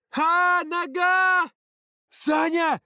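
very high loud shocked shouts; alarmed cries. Acted. Kids seeing Саня fall, very high loud alarm. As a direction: cry out in shock.

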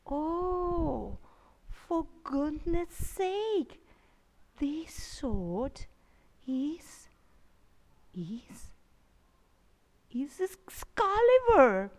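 hushed whisper of discovery; awed whisper. Acted. Merlin whispers in awe, finding the sword.

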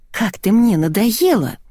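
slow, mildly whining complaint; whiny. A narrator's reading. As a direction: drag it out as a fed-up whine. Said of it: "занудила девочка". (нудно, с растяжкой)

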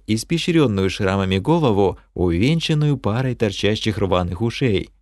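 ordinary delivery, nothing marked

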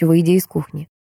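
plain reading voice

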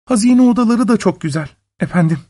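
quick, high woman's voice; submissive wife. A narrator's reading. Wife reassuring her husband about the rooms, high and quick. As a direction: answer high and hurried, deferential.

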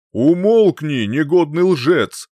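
loud angry rebuke, slowing at the end; furious exclamation. A narrator's reading. Old sage silences the lying youth, loud angry shout. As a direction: shout him down in anger. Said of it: "воскликнул он".

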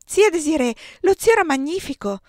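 put-on high, loud, breathless child calling out; breathless excitement. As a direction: burst in, thrilled and out of breath. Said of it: "quasi senza fiato per l'eccitazione".

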